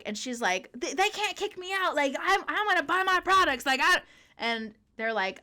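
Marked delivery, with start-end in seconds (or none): 0.7-4.0 high, loud mock protest; imitated screaming. Customer protesting to the cops about being kicked out, imitated yelling. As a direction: yell indignantly in a pitched-up imitation. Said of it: "on her phone screaming".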